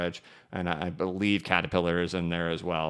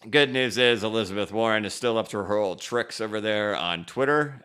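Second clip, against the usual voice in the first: normal talking voice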